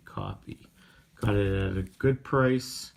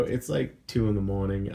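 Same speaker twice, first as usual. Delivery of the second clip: usual speaking voice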